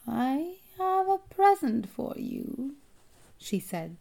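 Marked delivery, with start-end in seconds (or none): 0.0-2.8 high, slow, rasping witch. A narrator's reading. Strange woman luring a boy from a tree, rasping. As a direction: coax in a high metallic rasp, stretching the words. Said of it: "Her voice had a curious, rasping quality".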